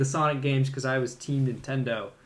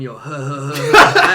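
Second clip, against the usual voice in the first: laughing Seth Rogen-style chuckle as Donkey Kong; lightly voiced goofy ape laugh, breaking into real laughter; laughing imitation of Seth Rogen as Donkey Kong; mock Donkey Kong voice; 12 dB louder, slower than usual. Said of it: "Donkey Kong going".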